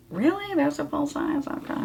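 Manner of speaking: small, high, hushed questioning voice; meek disbelief. Acted. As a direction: shrink back, softly and high, doubting it. Her acted meek reply to correction.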